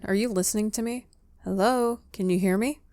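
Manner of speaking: normal talking voice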